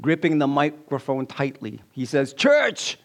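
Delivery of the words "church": high, punched preacher's call; charismatic preacher. Acted. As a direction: call out to the congregation like a revival preacher. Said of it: "a charismatic preacher".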